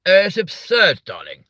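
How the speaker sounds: mock posh English drawl on "absurd". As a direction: say "absurd" like a plummy aristocrat. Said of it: "Makes you sound very posh".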